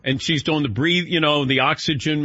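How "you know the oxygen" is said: ordinary talk, nothing marked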